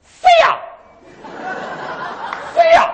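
very high, loud Tianjin squawk; parrot voice. Shown as a demonstration. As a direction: squeal it like a thin-voiced parrot. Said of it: "嗓音还特别的细".

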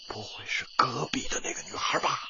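conspiratorial whisper; a teacher whispering his sly guess to the pupil. Acted. Teacher hints at secret crush.

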